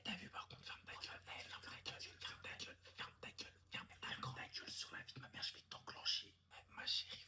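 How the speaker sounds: furious argument kept voiceless; angry whisper. Acted. Siblings threaten each other while mother sleeps, angry whispering. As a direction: trade threats in a heated whisper.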